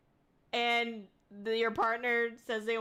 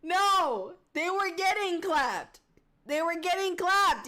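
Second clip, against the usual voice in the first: higher, louder insisting correction; insistent correction; 6 semitones higher, 5 dB louder, slower than usual. Real, not acted. Correcting chat on the joke, insistent and raised. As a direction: set them straight, emphatic and high. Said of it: "I thought the cheeks were clapping".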